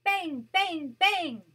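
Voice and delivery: shouted drum bangs, punchy and loud; playful loud drum noises for a child. Acted. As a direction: bang it out like a big drum.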